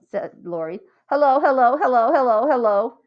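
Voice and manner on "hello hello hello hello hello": louder singsong taunt; taunting hellos. A narrator's reading. Lori taunting Jeff with repeated hellos, loud singsong. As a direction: needle him with repeated hellos.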